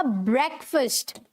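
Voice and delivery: ब्रेकफास्ट said "BREK-fust"; short vowels in both halves of ब्रेकफास्ट; correct pronunciation. Shown as a demonstration. Teacher modelling for students, ब्रेकफास्ट as "BREK-fust". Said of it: "and बोला जाएगा".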